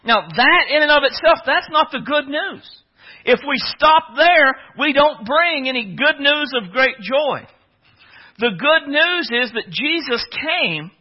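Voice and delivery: loud, high, emphatic sermon delivery; full-force preaching. Real, not acted.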